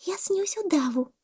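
very high, wondering monkey voice; monkey thinking. A narrator's reading. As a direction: think aloud in a tiny high voice. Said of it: "подумала мартышка". (мартышка думает, тоненьким голоском)

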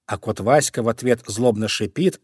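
ordinary delivery, nothing marked

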